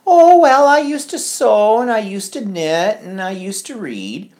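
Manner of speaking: put-on high, wistful old-lady voice, pitched well up; imitating her mother. Daughter mimics her mother's answer to the assessor in a high, wistful voice. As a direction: lift into a sweet elderly voice.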